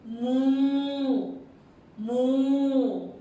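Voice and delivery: long, loud, held moo; cow moo. Pretend. Teacher voicing a cow for young learners, long loud moo. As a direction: draw the word out loudly like a cow.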